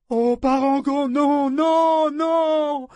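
very high, loud wail of protest; desperate cry. A narrator's reading. Ambre begging Parangon to spare the pirate, crying out. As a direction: cry out over the screams. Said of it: "le cri d'Ambre".

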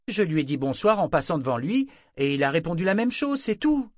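emportée. A narrator's reading. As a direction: protest hotly to the end. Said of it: "s'emporta Malta". (justification excédée qui monte dans l'aigu)

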